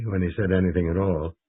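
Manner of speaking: usual speaking voice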